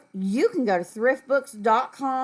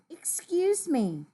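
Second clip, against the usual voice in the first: high, slow, polite call; polite request; 8 semitones higher, slower than usual. Acted. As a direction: ask politely, drawn out and higher. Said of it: "That is the polite way".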